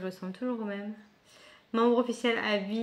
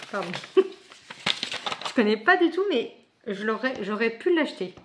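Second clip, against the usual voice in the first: normal talking voice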